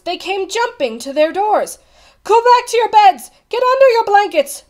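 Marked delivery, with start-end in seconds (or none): 2.2-4.6 urgent shout, high and loud; crying out. A narrator's reading. Bartholomew Cubbins warning frightened lords and ladies, crying out. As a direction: call out a loud, high-pitched order down the halls. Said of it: "went crying through the halls".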